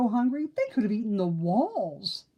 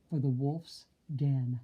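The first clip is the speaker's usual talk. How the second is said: plain reading voice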